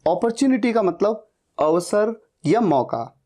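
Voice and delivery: normal talking voice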